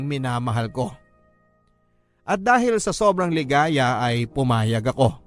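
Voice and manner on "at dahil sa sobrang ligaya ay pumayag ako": usual speaking voice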